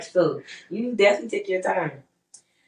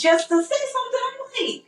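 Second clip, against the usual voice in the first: teasing squeal. Real, not acted. Teasing him over the week.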